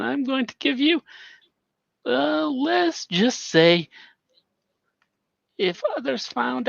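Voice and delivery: high, sly voice-modulated schemer; high-pitched mysterious informant. Acted. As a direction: play the high, secretive informant. Nikolai voiced in scene.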